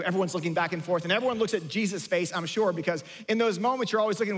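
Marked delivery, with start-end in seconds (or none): none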